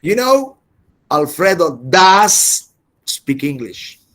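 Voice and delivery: does punched, slow and firm; emphatic stress. Shown as a demonstration. Teacher answering a doubter, does stressed slowly. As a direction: lean hard on does. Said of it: "I'm going to tell him that Alfredo speaks good English".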